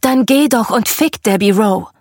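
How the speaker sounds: narrated jealous snap, mildly heated; jealous retort. A narrator's reading. Mother answering Michael's threat about Debbie Rowe, jealous retort. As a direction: throw it back with jealous irritation. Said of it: "Worauf meine Mutter eifersüchtig reagierte".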